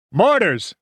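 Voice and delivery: high yelled warning; urgent battlefield shout. A narrator's reading. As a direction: yell a sudden alarm to the men. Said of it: "Winters yelled".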